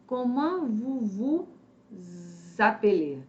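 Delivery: slow, linked vous vous appelez with a z sound; liaison demo. Shown as a demonstration. Teacher slowly models comment vous vous appelez for students. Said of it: "Então, é aqui assim".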